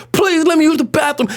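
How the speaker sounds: high-pitched begging; small kid's urgent plea; pleading child. Acted. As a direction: plead desperately with the nurse. Acted child begging the nurse.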